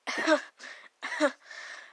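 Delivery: short unvoiced chuckle; breathy laugh. Real, not acted. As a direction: laugh at the slip. Reader laughs at own slip.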